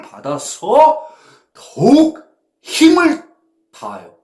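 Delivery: preacher rising to climax; building to a shout. Acted. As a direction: swell to a peak.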